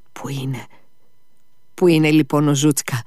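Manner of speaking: a hint of a sick boy's broken voice, hushed then rising. A narrator's reading. Sick boy asks about his lost dog, mildly broken voice. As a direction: ask it with a mild catch in the throat. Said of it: "με σπασμένη φωνή".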